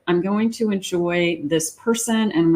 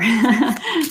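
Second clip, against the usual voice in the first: short amused chuckle; a little giggle escapes; light laugh; 6 semitones higher than usual. Real, not acted. Reader delighted that a wish is coming true, light laugh.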